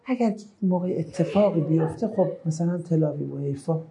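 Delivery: soft, hesitant, half-hearted threat; understated and noncommittal, as a parody; mock feeble delivery. Shown as a demonstration. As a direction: say it limply, trailing away.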